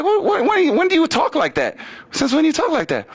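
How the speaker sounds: high-pitched stammering disbelief; baffled challenge. Retold or imitated. Baffled reply to her odd speech.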